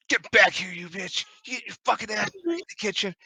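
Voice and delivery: game master playing a drunk man yelling after someone; mock drunken tirade; drunk angry man. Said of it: "You can tell he's obviously drunk".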